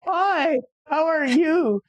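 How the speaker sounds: squeaky high nervous hi; nervous cracking greeting. Retold or imitated. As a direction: voice jumps way up and cracks with nerves. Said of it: "And my voice cracks".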